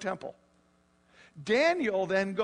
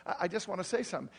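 usual speaking voice

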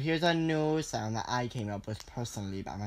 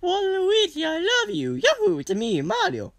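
squeaky cartoon voice; 9 dB louder than usual. Kid voicing Mario into recorder.

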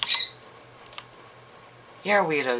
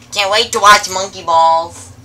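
ordinary delivery

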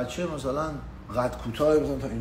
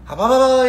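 high loud mock babble; imitated gibberish; 7 semitones higher, 9 dB louder than usual. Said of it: "یه کس شعری گفت".

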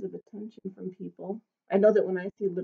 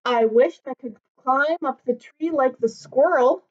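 higher, small wistful voice of a tiny dog; little dog Norbert, wistful; 6 semitones higher, 4 dB louder than usual. Acted. Tiny dog wishing he were big, higher and wistful. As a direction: sound small and longing.

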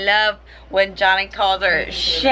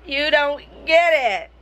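"You don't get it" said again, much higher; higher repeat; 9 semitones higher than usual. Real, not acted. Insisting to a listener who doesn't understand, higher. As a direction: push it up in pitch the second time. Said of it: "repeated line, second higher".

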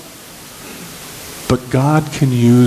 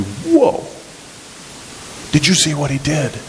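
awed; 4 semitones higher than usual. Acted.

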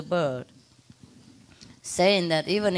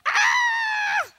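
very high piercing screech; imitated bird scream. Owner imitates bird screaming for attention, piercing screech. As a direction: screech like a protesting parrot. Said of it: "And then scream".

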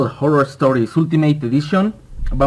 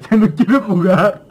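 higher, louder rattled voice; shaken; 5 semitones higher, 4 dB louder than usual. Real, not acted. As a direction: still shaken from the scare. Tras el susto. (voz alterada tras el susto)

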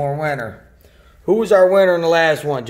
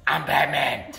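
low, terse Batman growl; gruff Batman; 6 semitones lower, 6 dB quieter than usual. Acted. Batman answering the announcer with his catchphrase. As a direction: answer deep and flat like Batman.

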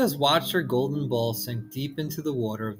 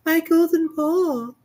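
very high, loud, joyful cry; overjoyed to see her ball back; high, loud princess delight; 6 dB louder than usual. Acted. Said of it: "said the princess".

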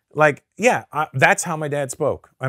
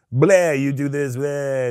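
lazy mocking voice, slow; hammed-up hack impression; mock dumb joker; slower than usual. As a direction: sneer it like a hack comic. Mocking lazy ethnic jokes.

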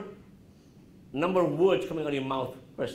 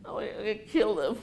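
mock anguish; 6 semitones higher than usual. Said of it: "You're like".

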